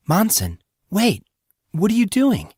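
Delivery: Cyan's pitch climbing in panic; alarmed call. Acted. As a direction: call after him, startled and worried. Cyan alarmed as Monson rises.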